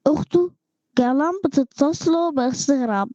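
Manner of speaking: usual speaking voice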